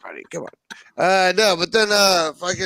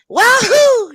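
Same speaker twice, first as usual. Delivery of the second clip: much higher, louder cartoon whoop; Mario wahoo; 9 dB louder than usual. Friend imitating Mario sixty-four's wahoo cry. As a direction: yelp it like Mario jumping. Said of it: "when he's like".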